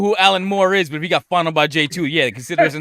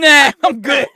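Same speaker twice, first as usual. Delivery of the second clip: louder, higher, laughing mock brush-off; mocking dismissal; 7 semitones higher, 8 dB louder, slower than usual. Retelling the co-host's rejection, laughing mock brush-off. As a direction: toss it off while laughing. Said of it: "And then he was like".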